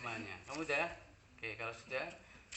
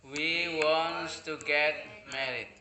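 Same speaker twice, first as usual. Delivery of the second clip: drawn-out classroom English; slow, careful example for the class; slow model sentence; slower than usual. Shown as a demonstration. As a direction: stretch each word for learners.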